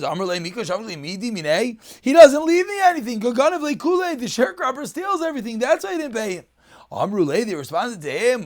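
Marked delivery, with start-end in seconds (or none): 2.0-6.4 pitched high, protesting his innocence; defensive protest. Acted. Rav Huna protesting to the rabbis, high defensive voice. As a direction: plead your side, voice raised high.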